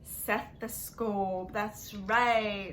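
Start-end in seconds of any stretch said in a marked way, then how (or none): 0.0-1.5 slow answer. Shown as a demonstration. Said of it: "teacher says the answer".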